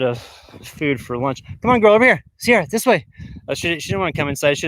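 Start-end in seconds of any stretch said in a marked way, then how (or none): 1.6-3.1 higher, louder pet-calling voice; calling the dog. Real, not acted. Owner calling the dog back inside, high urging. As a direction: call a wandering dog over, pitched up.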